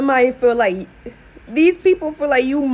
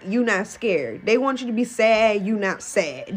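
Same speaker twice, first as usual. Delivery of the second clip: ordinary delivery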